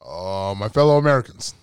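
measured politician impression; Barack Obama. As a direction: open a speech as a halting statesman. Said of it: "Barack Obama".

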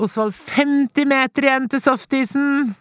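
put-on high, slow called-out cheer to a child; calling out. Grandmother calling to her grandson on the bike, retold mild call. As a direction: call back over your shoulder while cycling. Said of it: "roper jeg".

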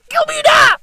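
very high, loud wail; hammed-up crying shriek; mock crying. Vlogger crying over his country's deal, high, loud mock wail. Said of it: "I'm out here crying".

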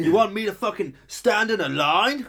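put-on incredulous protest; mock indignation. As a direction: imitate an affronted complainer, pitch climbing. Mimicking indignant mask refusers.

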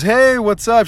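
high-pitched, breezy imitation of cousin; friendly phone greeting. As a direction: put on an innocent phone hello.

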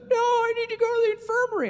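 very high mock wail; hammed-up tearful child's whine; bawling kid. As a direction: wail in protest like an upset kid. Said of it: "he literally starts bawling".

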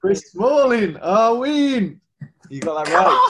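loud, high cheering; excited cheer. Real, not acted. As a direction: celebrate the right answer loudly. Cheering a correct guess.

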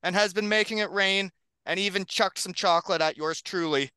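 big hype podcast announcer read; announcer intro. Acted. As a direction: introduce the guests like a showman. Host reads hype podcast intro.

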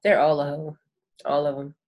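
normal talking voice

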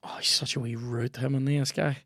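breathless whispered aside. Real, not acted. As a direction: whisper it under your breath. Whispering aside during match.

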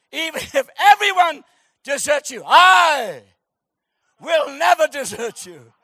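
loud, high, hammed-up declaration; Shakespearean vow. Peter swearing to Jesus in theatrical grandeur. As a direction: overact the loyal oath. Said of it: "a spirit of Shakespeare came on him".

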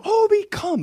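put-on very high, loud little boy's command; a small boy calling out the dog, trying to sound big. Little son commanding the family dog in his big boy voice. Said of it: "And he's like".

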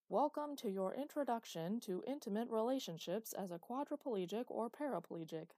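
an eighties training-tape narrator, bright and overeager; hammed-up announcer reading an old rehab video; cheesy video narrator. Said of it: "said the narrator".

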